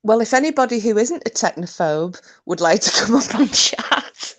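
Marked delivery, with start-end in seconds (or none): 2.5-4.4 high voice breaking into giggles; giggling hard between the words; helpless giggles. Real, not acted. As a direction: try to finish through the laughter. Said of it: "I've just got the mad ass giggles".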